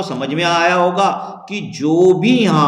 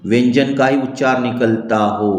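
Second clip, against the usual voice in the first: word-by-word dictation with long pauses; slow dictation; 4 semitones lower, slower than usual. Acted. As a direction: dictate slowly, drawing out each word for note-takers. Dictates the rule while writing. (धीमे, शब्द-दर-शब्द श्रुतलेख)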